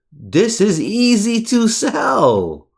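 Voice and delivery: high, slow, teasing call; mock-triumphant sing-song; sing-song gloat. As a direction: crow it out like it's too easy. Gloating over the chart.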